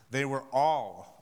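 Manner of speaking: ALL drawn out slowly with weight; stretched all. Acted. Preacher leading the room, stretching out all. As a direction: lean long on the word all. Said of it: "say all".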